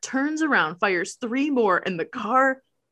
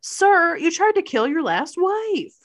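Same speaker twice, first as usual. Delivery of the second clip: higher, louder pushback; incredulous retort; 8 semitones higher, 4 dB louder than usual. Retold or imitated. Melissa calling out the doctor in disbelief. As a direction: call him out in disbelief. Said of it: "retorting to the doctor".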